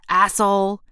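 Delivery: little sneer. A narrator's reading. Joking young man to a stranger in Starbucks, little sneer. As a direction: toss out the insult with a playful sneer. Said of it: "adding with a little sneer".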